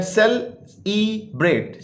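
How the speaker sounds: सेल, ई, bread as three separate beats; teacher sounding out सेल–ई–ब्रेट in pieces; syllable by syllable. Shown as a demonstration. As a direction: pause between सेल, ई and bread. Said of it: "ये three syllable word है".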